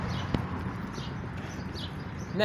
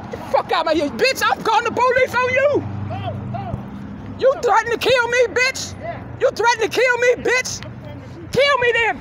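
furious shouting; 9 semitones higher than usual. Real, not acted. Screaming at the old man with the gun, furious and high. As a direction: yell him down, daring him to shoot. Street confrontation with armed man.

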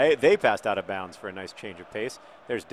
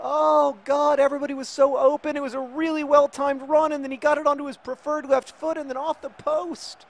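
high, loud, wailing rant; anguished lament; 7 dB louder than usual. Real, not acted. Gamer reliving a wasted chance, anguished high lament. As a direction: keep it pitched high in despair.

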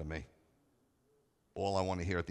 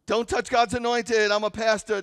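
lampoon a preacher claiming the verse; mocking a pastor who misquotes scripture, shouted; mock pompous pastor; 13 semitones higher, 12 dB louder than usual. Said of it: "put 'em on a t-shirt and go".

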